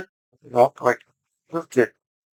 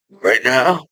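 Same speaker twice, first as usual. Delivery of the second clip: ordinary talk, nothing marked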